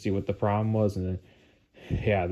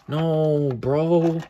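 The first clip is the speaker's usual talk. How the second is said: high, drawn-out protest of dismay; pained disbelief; 6 semitones higher, 3 dB louder than usual. Real, not acted. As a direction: plead with the bad news. Sees more damage to controller.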